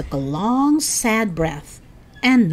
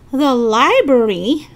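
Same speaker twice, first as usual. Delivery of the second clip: loud, high, drawn-out outcry; yelled disbelief; 6 semitones higher, 7 dB louder than usual. A narrator's reading. Eddie balks at going to the library, yelling. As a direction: yell it slowly in disbelief. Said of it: "Eddie yelled".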